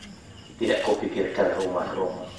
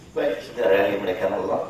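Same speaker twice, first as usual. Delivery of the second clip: ordinary talk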